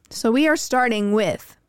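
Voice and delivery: ordinary delivery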